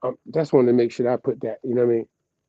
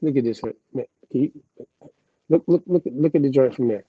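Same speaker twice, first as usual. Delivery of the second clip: normal talking voice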